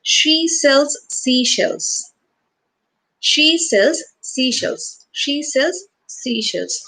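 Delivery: slow, careful drill of the twister; slow tongue twister. Shown as a demonstration. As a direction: spell it out slowly for the class. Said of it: "Do you remember the tongue twister?".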